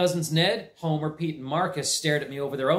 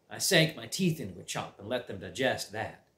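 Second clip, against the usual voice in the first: ordinary delivery, nothing marked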